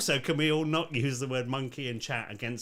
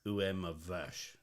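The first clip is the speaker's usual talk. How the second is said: French cowboy; 8 semitones lower, 10 dB quieter, slower than usual. Acted. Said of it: "What would a French cowboy say?".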